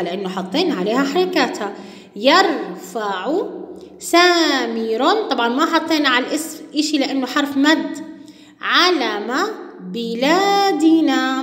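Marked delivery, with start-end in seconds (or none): 2.2-5.3 each word drawn out slowly for pupils; teacher reading slowly with full vowels; slow reading aloud. Shown as a demonstration. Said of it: "مرة أخرى". 8.6-11.4 each word drawn out slowly for pupils; teacher reading slowly with full vowels; slow reading aloud. Shown as a demonstration. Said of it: "مرة أخرى".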